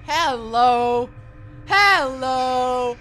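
loud slow victory gloat; triumphant. Real, not acted. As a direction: shout it like a champion. Triumphant after winning.

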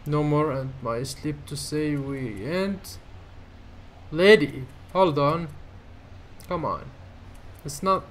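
ordinary talk

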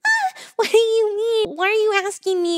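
real exasperated cry; exasperated outburst. Real, not acted. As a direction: blurt out your disbelief at them. Outburst at the strangers' question.

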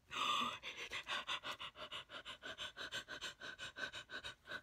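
sharp gasp for breath; excited gasp. Real, not acted.

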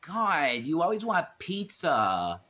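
put-on whiny Valley girl complaint. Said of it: "I sound like a Valley girl".

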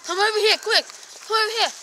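calling out high and urgent; calling from far away. Pretend. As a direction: call the cameraman over in a hurry. Calling the cameraman over.

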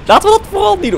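high, loud burst of insistence; emphatic exclamation. Real, not acted. Player deciding against going outside, emphatic high exclamation. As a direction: jump up in pitch and volume. Emphatic repeat of the same decision.

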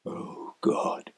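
very soft, breathed appeal; whispered despair. Acted. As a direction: whisper it like a desperate prayer.

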